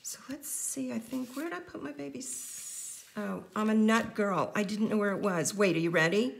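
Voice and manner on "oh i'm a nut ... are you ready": ordinary talk, nothing marked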